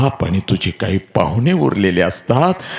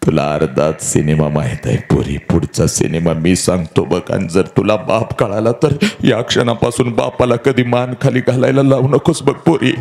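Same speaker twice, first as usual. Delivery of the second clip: ordinary delivery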